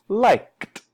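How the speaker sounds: "liked" high, ending in released "t"; T ending demonstrated. Shown as a demonstration. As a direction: say "liked" like "likt". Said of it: "more like the silent phone".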